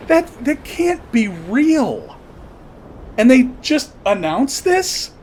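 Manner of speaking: can't believe what he's reading; stunned disbelief. Real, not acted. Disbelief at news of payouts.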